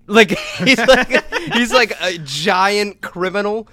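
can't stop laughing as he tells it; giggling out the words, slowed by laughter; laughing while talking. Real, not acted. Laughing while describing criminal.

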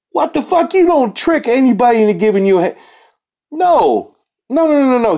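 high, incredulous outburst; can't believe what he's hearing; sputtering high-pitched no-no-no; outraged disbelief. Real, not acted. Outraged disbelief at abuser's claim.

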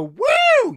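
shrieked high woo, arms up; mock party woo. Comic imitating a heckler's piercing woo. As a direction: imitate the drunk lawyer's woo. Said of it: "see you go".

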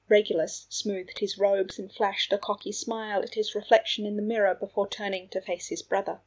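plain reading voice

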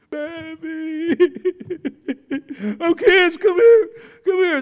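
hammed-up sobbing in a high voice; mock whining. Preacher mimicking a father calling his kids, mock crying. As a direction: blubber theatrically, high and wobbling into loud. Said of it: "whining, griping".

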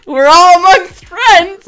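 loud, slow, drawn-out catchphrase; quoting Jason loudly. Retold or imitated. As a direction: belt the catchphrase out slowly. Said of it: "And he was like".

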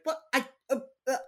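mock flustered stammer; acting out someone caught red-handed, tripping over words; flustered denial. Retold flustered denial when caught out.

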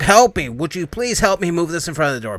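high, loud panicked begging; frantic plea to barricade the door. Retold or imitated. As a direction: beg for help in a panic. Panicked man pleading in movie recap.

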